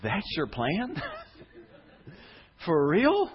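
acted disbelief with a laugh; incredulous. Doubters hearing God's plan for the city, mock incredulous. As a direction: question the plan, pitch jumping up at the end. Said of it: "some people in the hearing of that who went".

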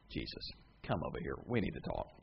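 low, hushed word to Jesus; confidential aside. Acted. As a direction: take him aside, voice dropped and soft. Peter takes Jesus aside privately.

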